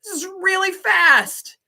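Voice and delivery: high-pitched retold amazement, drawn out; astonished reaction. Teacher retelling her reaction to practice exams, high-pitched astonishment. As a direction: blurt it out in playful overwhelmed disbelief. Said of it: "and I'm like".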